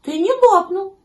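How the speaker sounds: high, loud kitten voice; high-pitched little cat; Гав. A narrator's reading. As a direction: reassure in a piping kitten voice. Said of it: "сказал котёнок Гав".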